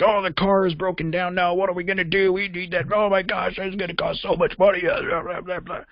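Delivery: high-pitched mock whiny rant; whiny, panicky complaining in a put-on high voice; frustrated fit. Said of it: "throwing a fit and getting all frustrated".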